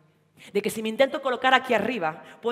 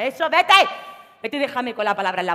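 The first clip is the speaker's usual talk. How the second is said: angry; 2 semitones higher, 8 dB louder than usual. Acted. Antoñita yells at leaving boyfriend.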